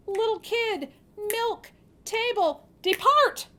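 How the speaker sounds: high and loud to the last word; girl calling out the magic words; excited spell. Acted. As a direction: call the charm with delight. Girl sends table away.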